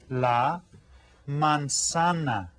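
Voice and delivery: la manzana said slowly, each syllable clear; slow model word. Shown as a demonstration. Teacher modelling la manzana slowly for kids. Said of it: "Can everyone say that?".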